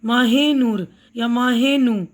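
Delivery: ماہ نو said slowly with the ہ of ماہ fully sounded, maah-e nau; ماہ نو with an audible h, drawn out; full h, slow. Shown as a demonstration. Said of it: "ہے کی آواز جو پوری ادا ہو رہی ہے".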